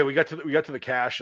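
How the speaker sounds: ordinary talk, nothing marked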